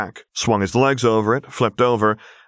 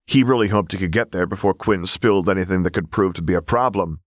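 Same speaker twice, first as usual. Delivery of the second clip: ordinary delivery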